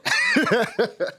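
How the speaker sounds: high-pitched real laugh; burst of laughter. Real, not acted. Chair jokes about dismissing the panel, high laugh. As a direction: laugh out high at your own joke.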